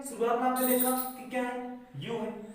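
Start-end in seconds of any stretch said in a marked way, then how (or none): none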